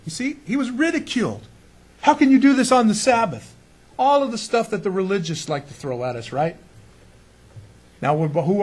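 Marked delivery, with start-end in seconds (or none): 2.1-3.5 mock outrage. Pharisees mock Jesus.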